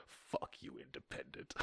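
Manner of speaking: breathy whisper, gloating; whispered taunt. Acted. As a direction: hiss the taunt under breath.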